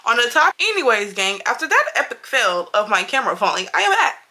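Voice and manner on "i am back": higher, proud comeback announcement; triumphant return. Real, not acted. Vlogger greeting viewers after the camera fall, high and triumphant. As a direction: announce your return like a victory.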